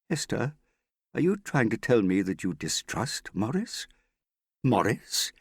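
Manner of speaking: high, dramatic disbelief; theatrical offended astonishment; pained incredulity. A narrator's reading. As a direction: sound wounded and incredulous. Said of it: "dramatic blend of pain and incredulity".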